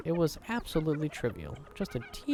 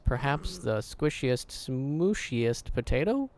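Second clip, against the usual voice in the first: drawn-out savoring; slower than usual. Acted. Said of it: "It's all in the delivery".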